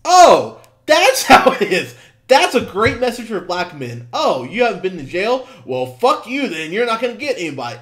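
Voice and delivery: high, loud sarcastic parody of the line; mocking outrage. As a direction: ham up how dumb the line sounds. Mocking the movie's message.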